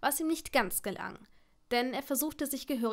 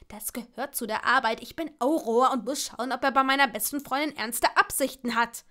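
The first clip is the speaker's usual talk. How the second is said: firm, raised-voice retort; loud self-justification; 7 dB louder than usual. A narrator's reading. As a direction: insist on your right to know. Quarrel on the phone continues.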